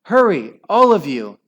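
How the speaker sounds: frightened mother shouting to her mouskins; urgent shout. Acted. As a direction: hurry the children along, loud. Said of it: "cried Hyacinth".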